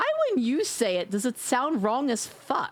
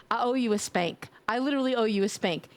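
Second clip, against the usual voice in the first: ordinary delivery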